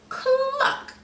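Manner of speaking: high, sharp chicken cluck; hen cluck. Acted. Hen pointing Gruntly toward the log with a high cluck.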